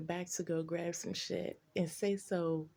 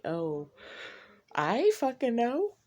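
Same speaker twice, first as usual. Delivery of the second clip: mock innocent; 3 semitones higher, 4 dB louder than usual. To him in the car, feigning innocence. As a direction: play dumb with a bright lilt. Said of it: "I was just like".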